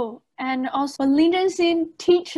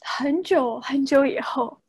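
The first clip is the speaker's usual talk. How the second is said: put-on Taiwanese accent. Imitating the movie's Taiwanese line.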